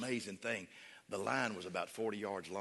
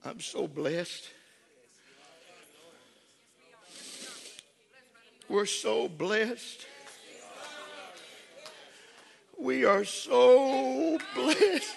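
building praise; 10 semitones higher, 6 dB louder, much slower than usual. Real, not acted.

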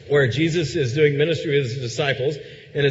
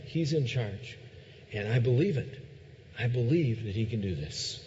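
softer, prayerful voice of surrender; quiet sincere vow; 5 semitones lower, 6 dB quieter than usual. Acted. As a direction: speak it like a personal, heartfelt pledge. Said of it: "the surrender step says".

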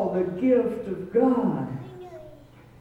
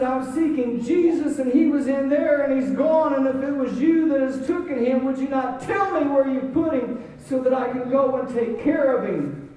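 preacher voicing a distraught plea; higher, pressing appeal to a stranger; urgent pleading; 6 semitones higher, 4 dB louder than usual. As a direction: plead it out earnestly, easing at the end. Mary pleads with supposed gardener.

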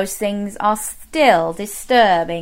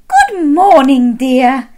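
cheery Missus Bear; 3 semitones higher, 5 dB louder, slower than usual. Acted. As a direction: sing out a morning greeting. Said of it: "she said".

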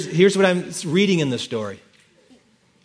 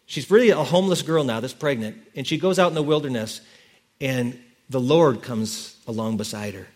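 usual speaking voice

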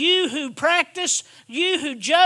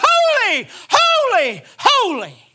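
shouted holy; 10 semitones higher, 7 dB louder, slower than usual. Retold or imitated. Preacher to congregation, voicing the angels' loud cry. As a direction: proclaim holy, holy, holy with a loud rising cry, each holy falling. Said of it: "They said".

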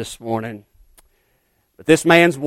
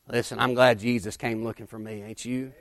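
ordinary delivery

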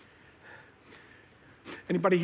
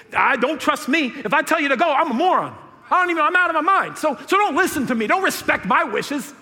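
high, loud and urgent; higher and louder, fast at the peak; fervent plea; 9 semitones higher, 5 dB louder than usual. Real, not acted. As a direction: plead against yourself. Preacher begs Jesus to ignore him.

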